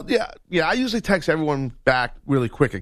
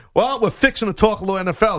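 a light Southern twang on "fixing to"; Southern accent; 6 semitones higher, 3 dB louder, faster than usual. Shown as a demonstration. Host showing off his Southern "fixing to", light Southern accent. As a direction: lean into a Southern accent, fast and playful. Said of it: "There's a little Southern for you right there".

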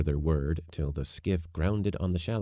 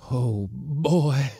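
rapturous uncle; 8 semitones higher, 6 dB louder than usual. Acted.

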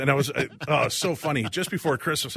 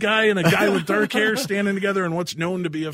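mock scolding; 7 semitones higher, 6 dB louder than usual. Friends mocking the fan.

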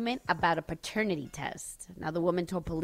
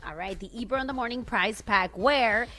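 booming prize announcer; 5 semitones higher, 4 dB louder than usual. Acted. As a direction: announce the prize like a radio host. Radio contest giveaway read.

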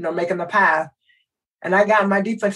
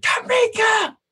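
high yell of the name, carrying across the gym; shouted name; slower than usual. Retold or imitated. Coach yelling the player's name across practice, a high shout. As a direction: call her out sharply from across the court.

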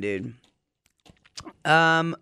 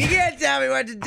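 imitated yelling; 9 semitones higher than usual. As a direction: play a drunk customer yelling back. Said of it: "You're yelling at the drive-thru lady".

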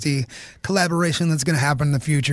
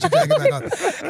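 much higher, mock-urgent repeat; mock high call. Staff begging the shirtless singer, high mock call. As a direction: squeak it up like frantic staff. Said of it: "they're like".

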